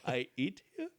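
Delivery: lightly voiced caveman asking. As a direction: dumb caveman question.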